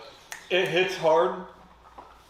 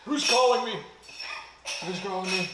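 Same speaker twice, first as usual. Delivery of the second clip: high pained cries and laughs; yelping through the burn; mouth burning from spicy food; 9 semitones higher, slower than usual. Real, not acted. Eating a ghost pepper, in pain.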